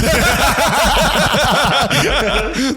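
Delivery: long, high burst of real laughter; hearty laugh. Real, not acted. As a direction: crack up laughing. Cracks up after "arguably" joke.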